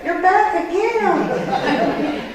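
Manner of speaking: high, very slow, drawn-out mock greeting; teasing greeting. Vicki greeting her at the church door, high and teasing. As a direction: sing it out like a friend teasing her.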